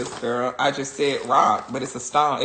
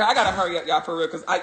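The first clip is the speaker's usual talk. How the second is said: usual speaking voice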